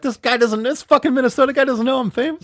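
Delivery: high-pitched put-on outrage; imitating a miffed star in disbelief; mock indignation. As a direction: play an offended celebrity, up high. Voicing offended famous people.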